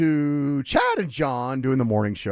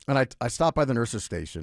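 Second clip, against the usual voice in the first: normal talking voice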